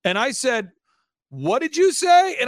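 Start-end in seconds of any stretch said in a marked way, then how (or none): 1.3-2.4 loud, slow, high disbelieving question; stunned question. Retold or imitated. To the weeping client, a slow, loud, stunned question. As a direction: ask it slowly in shocked disbelief.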